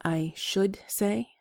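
"I should say" drawn out at half speed, should stressed and rising; slow, stressed should. Shown as a demonstration. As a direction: spell out should slowly, like a teacher. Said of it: "It's important that you say".

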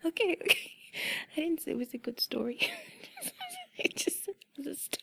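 high, quick defence through giggles; giggly protest. Real, not acted. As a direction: break out of the whisper, laughing and protesting. Giggling defence of the silly story.

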